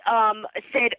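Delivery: ordinary talk, nothing marked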